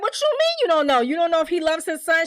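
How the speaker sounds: high, pressing disbelief; mock incredulous. Acted challenge from alarmed Keisha.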